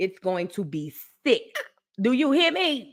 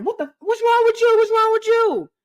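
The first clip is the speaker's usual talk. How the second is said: high, loud mock alarm from her man; alarmed boyfriend; 12 semitones higher, 5 dB louder than usual. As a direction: play him shouting in a panic, pitched up. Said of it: "my man was like".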